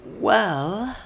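high, rising hesitant opener; sheepish stalling. A narrator's reading. Elizabeth stalling under Thales's question, sheepish high voice. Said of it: "Elizabeth said again".

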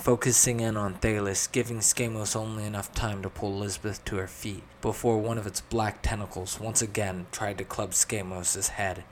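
ordinary delivery, nothing marked

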